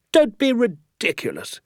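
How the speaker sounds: high, exclaimed protest; incredulous scoffing. Acted. Doctor scoffs at the claim.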